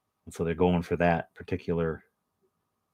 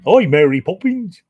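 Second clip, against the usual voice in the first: higher, louder mock cockney greeting; 11 semitones higher, 10 dB louder than usual. Mocking a famous actor's fake cockney greeting Mary Poppins. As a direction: chirp it like a chimney sweep hamming cockney.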